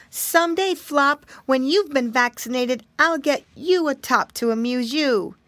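high-pitched little piggy voice; Curly, little piggy boy. A narrator's reading. Piggy boy promising his brother a top, high childlike voice. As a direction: pitch it up like a young piglet.

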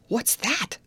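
a sudden high startled question; startled cry. A narrator's reading. Grace cries out when the boat strikes something, startled. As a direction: jump at the jolt of the boat. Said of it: "cried Grace".

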